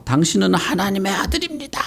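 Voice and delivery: high-pitched mock scream, held back in volume; high, restrained imitation shriek; crying-out demon. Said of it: "막 소리를 지르면서 나갔어".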